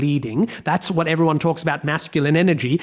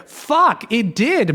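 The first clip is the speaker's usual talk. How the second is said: a much higher startled "Fuck, it did"; sudden realisation; 7 semitones higher, much slower than usual. Retold or imitated. Man realising he was the problem, startled exclamation. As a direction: catch yourself, stunned.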